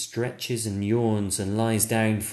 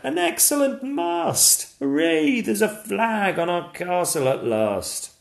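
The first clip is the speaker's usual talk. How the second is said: much higher, loud happy dad; cheerful yell; 12 semitones higher, 5 dB louder than usual. Acted. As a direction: shout it out on the beach. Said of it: "yells the dad".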